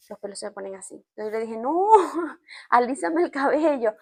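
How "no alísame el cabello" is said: higher, laughing through a retold request. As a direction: plead with a giggle. Retelling her request to stylist. (pedido entre risas, más agudo)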